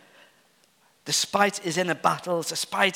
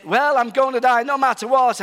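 gloomy pessimist; 6 semitones higher, 6 dB louder than usual. Retold or imitated. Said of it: "He was saying".

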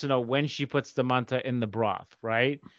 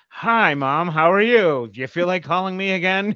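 louder, higher seething mock greeting; Hulked-out son; 5 semitones higher, 5 dB louder than usual. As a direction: greet mom sarcastically, about to burst. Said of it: "the fucking Hulk walking in".

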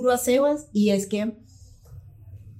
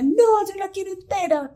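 put-on much higher little kid's voice, insisting; stubborn child; 13 semitones higher than usual. Son demanding his apple whole, in a high childish voice. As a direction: dig in like a toddler.

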